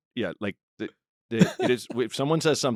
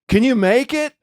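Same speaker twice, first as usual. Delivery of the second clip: high pleading question; 13 semitones higher, 8 dB louder than usual. Retold or imitated. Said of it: "He's like".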